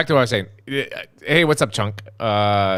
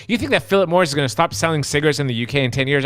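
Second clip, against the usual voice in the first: normal talking voice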